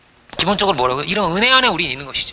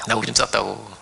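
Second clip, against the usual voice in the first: much lower and softer, embarrassed; ashamed confession; 8 semitones lower, 5 dB quieter, slower than usual. Retold or imitated. Child confessing to mom that he wet himself, softly ashamed. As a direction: mumble it low, ashamed.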